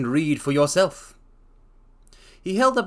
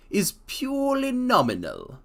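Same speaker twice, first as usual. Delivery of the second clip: higher, slow character voice; a different, slower man answering; Duncan Ross voice; 9 semitones higher than usual. Acted. Ross names the wage in a higher, slower voice.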